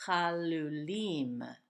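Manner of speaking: chalulim read very slowly, drawn out; a slow teaching read of chalulim; slow and careful. Shown as a demonstration. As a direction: spell it out in sound, slowly. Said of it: "the two words the same as well".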